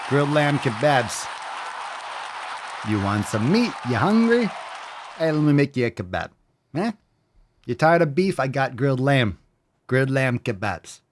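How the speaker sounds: put-on kebab seller's accent of no clear origin; hammed-up street vendor in a made-up accent; unidentifiable accent. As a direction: pitch the meat in a vague foreign accent. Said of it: "I don't even know what accent that is".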